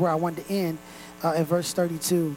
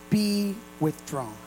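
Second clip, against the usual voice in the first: "be withdrawn" drawn out slowly, falling; slow reading; 3 dB quieter, slower than usual. Shown as a demonstration. Pastor slowly reading scripture to the congregation. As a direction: let "be withdrawn" sink in slowly. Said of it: "in the other version".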